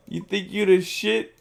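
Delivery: mock New York accent taunt. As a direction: spit the line like a New York hard case. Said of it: "The New York accent".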